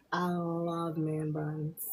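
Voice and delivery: ordinary talk, nothing marked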